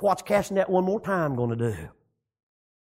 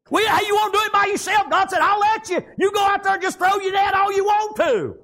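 high, driving country sermon delivery; raised, ringing pulpit voice; full-force preaching; 12 semitones higher than usual. Real, not acted. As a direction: preach it out hard, drawing out the line ends.

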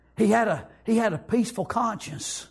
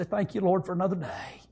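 soft, lowered retold prayer; quiet thanks; 3 semitones lower, 6 dB quieter than usual. Preacher retelling his wave to heaven, quiet low thanks. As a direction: let it sink into a humble murmur.

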